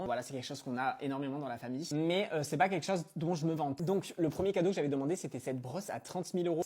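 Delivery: put-on spoiled rich girl. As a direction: play a bored spoiled heiress. Spoiled rich girl haul parody.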